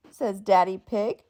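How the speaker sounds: ordinary delivery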